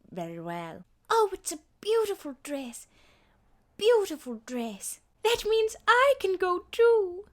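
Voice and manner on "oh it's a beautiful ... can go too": high, sweet transatlantic princess; wide-eyed Disney ingenue; Cinderella voice. Acted. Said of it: "a marble underneath my tongue".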